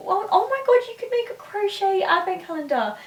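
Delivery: high-pitched burst of excitement; thrilled, voice leaping high; excited sudden idea. Real, not acted. Struck by a sudden gift idea.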